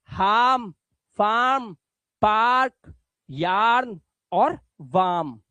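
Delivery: normal talking voice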